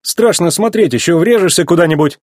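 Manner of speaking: high-pitched nervous warning; nervous worry. A narrator's reading. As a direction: fret that a crash is coming. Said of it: "с дрожью в голосе".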